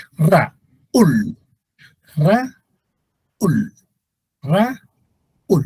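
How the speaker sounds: "Raúl" split with a pause, Ra... ÚL; hiatus demo. Shown as a demonstration. Teacher drilling a two-syllable name, split "Raúl". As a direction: pull A and stressed Ú apart in "Raúl". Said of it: "No decimos Raúl".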